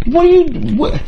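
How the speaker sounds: high, incredulous tirade at a camera; indignant, sputtering disbelief; exasperated rant. Real, not acted.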